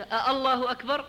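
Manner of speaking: questioning double hamza, lifted ending on أكبر. Shown as a demonstration. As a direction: separate "a-" before الله, rising on أكبر. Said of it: "كأنه يسأل".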